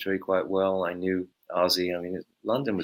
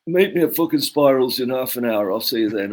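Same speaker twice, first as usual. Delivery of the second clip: raised, louder gruff rock-star phone voice; imitating a bossy rock star's invite; 4 semitones higher, 5 dB louder than usual. Rock star on the phone summoning the bassist, imitated louder. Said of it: "That's pretty good".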